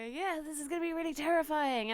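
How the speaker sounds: excited hopeful voice; hopeful excitement. Retold or imitated. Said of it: "with great hopes and go".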